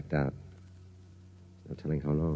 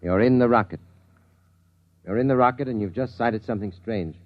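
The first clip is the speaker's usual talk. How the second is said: loud, higher, pressing command; insistent hypnotic suggestion; 5 semitones higher, 6 dB louder than usual. Acted. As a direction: drive the suggestion into his mind. Hypnotist pushes his suggestion.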